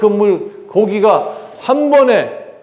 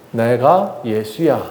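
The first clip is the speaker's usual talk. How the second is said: solemn reveal; 6 semitones lower, 3 dB quieter, much slower than usual. Acted. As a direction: let each word land gently, lower and softer. Jesus reveals himself slowly. (천천히 낮고 부드럽게 자신을 드러내는 예수)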